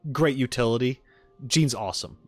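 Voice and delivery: normal talking voice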